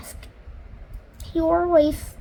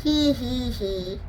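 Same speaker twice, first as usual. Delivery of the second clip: a joyless forced little he-he-he; miserable giggle; slower than usual. A narrator's reading. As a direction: laugh without any joy at all. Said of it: "she said miserably".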